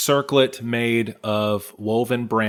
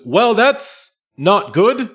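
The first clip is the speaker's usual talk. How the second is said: higher, worried NPC voice; a guide alarmed by the yelling; raised voice of a guide hurrying to check the noise; Curse, concerned; 9 semitones higher, 4 dB louder than usual. Acted. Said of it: "Curse says".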